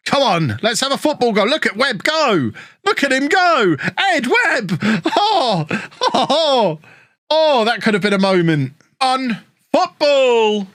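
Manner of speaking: high, rising shout-along as the chance builds; excited commentary. Real, not acted. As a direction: cheer your striker forward with a laugh. Live goal chance, excited commentary.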